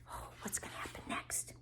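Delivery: hushed, quick aside; suspenseful whisper. Acted. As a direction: whisper it to keep children guessing.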